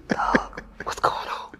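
breathy whispered aside; hissing it under his breath to himself. Retold or imitated. Whispering to himself at [name]'s show.

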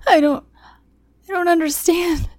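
high, loud sobbing plea; tearful, high-pitched begging; pleading through sobs. A narrator's reading. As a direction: choke out a desperate apology. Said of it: "I pleaded behind sobs".

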